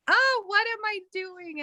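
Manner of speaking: high-pitched imitated self-reproach; mock alarm. Acts out sudden morning self-reproach.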